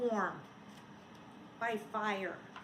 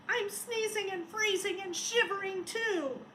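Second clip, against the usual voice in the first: shivering snowman; 6 semitones higher than usual. Acted. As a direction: sound like a freezing little snowman. Snowman voiced in story.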